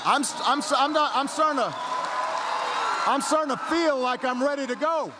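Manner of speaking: loud, high, stumbling into excitement; getting fired up. Acted. Candidate telling crowd he caught the chant, fired up. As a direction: build up like a rally speaker catching the fever.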